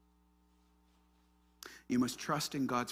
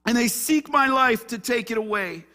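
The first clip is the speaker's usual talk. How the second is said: high, loud lament; loud discouragement; 11 semitones higher, 11 dB louder than usual. Acted. Elijah complains to God in the cave, discouraged outcry. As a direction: cry it out to God in protest. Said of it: "Hear the discouragement?".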